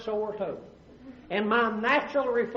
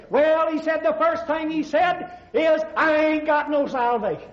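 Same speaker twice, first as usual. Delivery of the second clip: panicked patient; 6 semitones higher, 4 dB louder than usual. Acted. Patient panics telling his wife.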